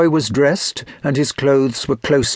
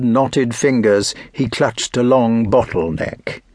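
plain reading voice

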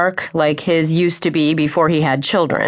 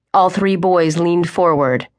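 usual speaking voice

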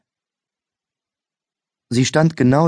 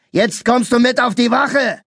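bellow; 13 semitones higher than usual. A narrator's reading. Mustached policeman bellowing the arrest at the boy. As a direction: roar the arrest. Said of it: "brüllte der mit dem Schnauzbart".

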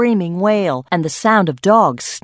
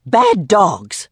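stern snap; 3 semitones higher, 4 dB louder than usual. A narrator's reading. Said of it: "snapped Myrtle sternly".

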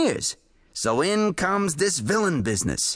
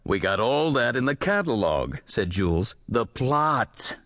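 weary editor; 5 semitones lower than usual. A narrator's reading. Editor flatly demanding plot.

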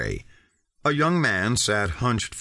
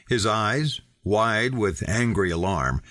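usual speaking voice

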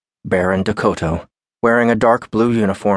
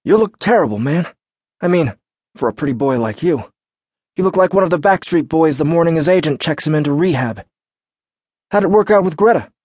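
teasing guard voice; 9 semitones higher than usual. A narrator's reading. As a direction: tease him like an old friend. Baron teasing Alex.

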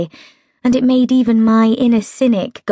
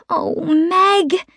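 helpless; 9 semitones higher than usual. A narrator's reading. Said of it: "I said helplessly".

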